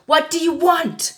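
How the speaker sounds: high, loud, frantic demand; film scene impression. Reader re-enacting a film argument, loud impassioned impression. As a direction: shout it as an overwrought movie quarrel. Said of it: "If you don't know what film I'm doing".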